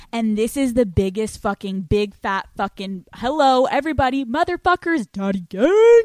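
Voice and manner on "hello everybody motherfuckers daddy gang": loud, rising call to everyone. Real, not acted. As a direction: shout it out to the whole crowd. Calling out to audience.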